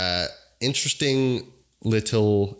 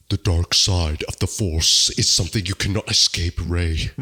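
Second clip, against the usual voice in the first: Kylo Ren voice; 3 semitones lower than usual. Retold or imitated. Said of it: "She sounds like she's going".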